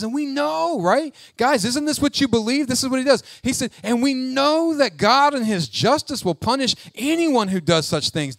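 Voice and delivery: high, rhetorical know-it-all challenge; condescending. Acted. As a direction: talk down to them as if it's obvious. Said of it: "it's almost like condescending".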